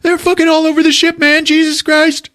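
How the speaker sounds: high, loud, fast panic; terrified yelling. Retold or imitated. Podcaster voicing a terrified crewman, frantic. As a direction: shriek it out like they're everywhere.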